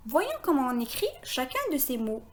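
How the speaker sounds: normal talking voice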